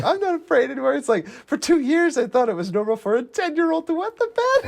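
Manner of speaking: high put-on teacher voice; a hammed-up high teacher voice; mock teacher. Teacher quoted from the movie.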